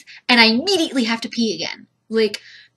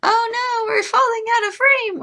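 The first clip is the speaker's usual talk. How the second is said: usual speaking voice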